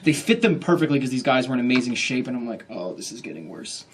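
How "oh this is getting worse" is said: muttered dread. Retold or imitated. Inner dread at rivals' looks.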